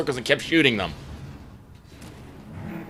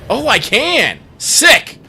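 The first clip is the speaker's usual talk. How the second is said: excited shout; 9 semitones higher, 5 dB louder, much slower than usual. Real, not acted. Excited gamer hitting target.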